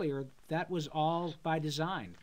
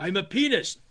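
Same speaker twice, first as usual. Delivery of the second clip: emphatic cartoon Beethoven outburst; higher, louder mock declaration; Beethoven quote; 5 semitones higher, 8 dB louder than usual. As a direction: proclaim it like a cartoon composer. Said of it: "Beethoven kept saying".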